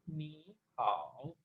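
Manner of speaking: नी rising, then हाओ dipping low, slowly; second plus third tone. Shown as a demonstration. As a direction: lift नी and sink deep on हाओ. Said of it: "second में करके पढ़ा करेंगे".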